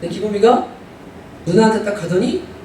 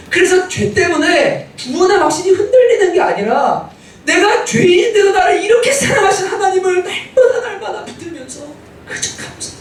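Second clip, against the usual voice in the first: fervent preaching; 10 semitones higher than usual. Acted. Pastor urging the congregation to cling to the cross, fervently. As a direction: preach it out to the whole church.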